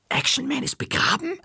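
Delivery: very high, breath-caught question; squeaky, aghast whisper-cry; gasping shock. A narrator's reading. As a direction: gasp it out in horrified disbelief. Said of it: "Ich schnappte nach Luft".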